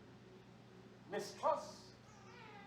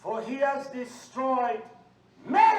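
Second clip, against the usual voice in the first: slow build exploding on "many"; loud and measured, then a high shouted last word; fiery preaching; 4 semitones higher, 11 dB louder, slower than usual. Real, not acted. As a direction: erupt on the final word.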